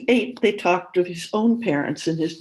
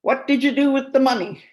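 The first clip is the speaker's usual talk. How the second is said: put-on higher, louder old man's demand; 8 semitones higher, 6 dB louder than usual. A narrator's reading. Father demands daughter explain the sold car, loud. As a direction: demand an answer, suspicious. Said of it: "he demanded".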